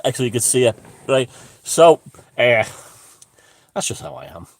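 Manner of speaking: ordinary delivery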